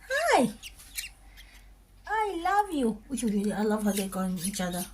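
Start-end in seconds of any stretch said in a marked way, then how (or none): 0.1-3.0 cooing. Real, not acted. Cooing to the animals.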